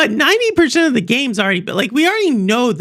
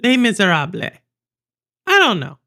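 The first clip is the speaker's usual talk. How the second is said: Spanish-accented French; 4 semitones lower than usual. Shown as a demonstration. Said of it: "I have, like, a Spanish accent".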